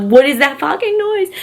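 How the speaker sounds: high-pitched panicked reenactment; alarmed outburst, retold in a high voice. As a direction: replay a startled panic, pitch jumping up. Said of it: "I'm panicking 'cause I'm like".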